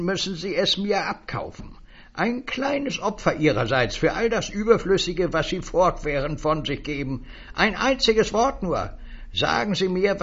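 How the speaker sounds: a high, eager little stranger selling words; high, wheedling salesman voice. Acted. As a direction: pitch it up like a pushy little peddler. Stranger demands payment.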